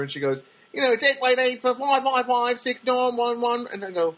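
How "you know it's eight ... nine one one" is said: high, loud singsong number recital; mimicked Australian lady. A lady customer reciting her phone number, high mimicked voice. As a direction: call the digits out high and slowly, mimicking her.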